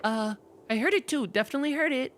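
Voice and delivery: put-on high, insistent kid. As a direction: kid backing up his story.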